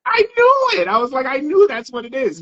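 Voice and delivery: high-pitched, thrilled "I knew it"; excited recognition. Retold or imitated. Host retells hearing the author's voice, excited recognition.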